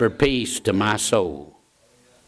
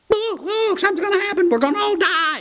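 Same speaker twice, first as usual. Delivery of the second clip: high, frantic cry of alarm; panicked crowd; faster than usual. Retold or imitated. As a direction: voice the terrified bystanders crying out high. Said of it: "people were excited around him".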